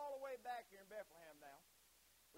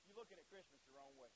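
ordinary talk

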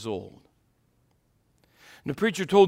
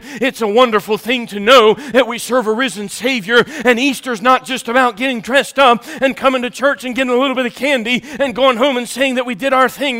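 ordinary delivery

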